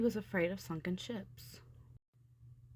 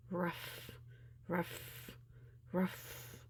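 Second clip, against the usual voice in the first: softer, distant, breathy barks; muffled monster bark; 6 dB quieter, much slower than usual. A narrator's reading. Hidden monster barking from inside the ship, muffled.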